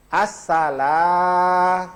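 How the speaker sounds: Assalam drawn out slowly with a clear lam; careful alif lam. Shown as a demonstration. Ustaz showing the alif lam, slow and deliberate. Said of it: "Alif lam itu".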